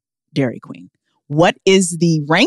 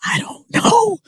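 mock whispering worry; 7 semitones higher than usual. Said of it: "I'm like".

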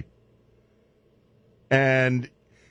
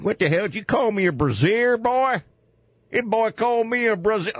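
hillbilly yokel; 9 semitones higher than usual. Pretend. Hillbilly mishearing brah.

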